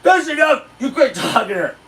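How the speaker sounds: sharp high barked order from an imitated rookie cop; mock cop snapping at him; mock stern cop. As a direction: bark it like a self-important cop. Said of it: "Starsky comes in".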